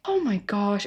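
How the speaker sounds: hushed dismay; appalled murmur. Real, not acted. Commentator aghast at the rude girlfriend, appalled murmur.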